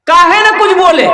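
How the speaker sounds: high-pitched acted reproach; exasperated mother's outburst; scolding mother. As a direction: scold your son for embarrassing you. Mother scolds her silent son.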